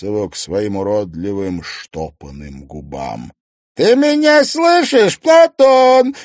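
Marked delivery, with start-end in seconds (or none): 3.7-6.1 high, loud mocking taunt; mock him over the headset. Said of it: "насмешливо проговорил он".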